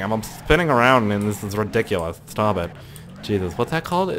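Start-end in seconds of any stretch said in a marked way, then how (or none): none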